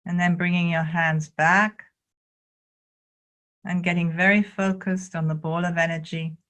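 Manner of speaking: calm, measured guiding with long pauses; spacious meditation instruction; guided qigong meditation. Real, not acted. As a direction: lead them gently through each movement, unhurried.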